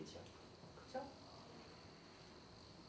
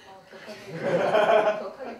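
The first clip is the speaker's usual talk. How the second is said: laughing loudly; 3 semitones lower, 16 dB louder, much slower than usual. Real, not acted. Laughing at the crowd for reacting so strongly, loud laugh. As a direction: burst out laughing on 격하게.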